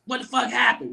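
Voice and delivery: high-pitched imitation of a baffled boss; mock outraged question, pitched high; retold indignant disbelief. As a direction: react to bad news, voice shooting up. Retells Rudy's reaction to bad news.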